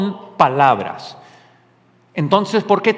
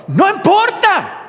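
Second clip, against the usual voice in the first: high, slow, forceful exclamation; emphatic dismissal; 12 semitones higher, 4 dB louder, slower than usual. Real, not acted. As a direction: wave the worry away, pitched way up and drawn out. Emphatic answer to mocked worries.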